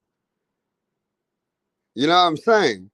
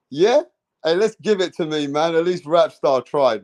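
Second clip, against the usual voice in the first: ordinary talk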